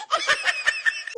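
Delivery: high squealing laugh. Real, not acted. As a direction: burst into a delighted high laugh. Wife thrilled by gift.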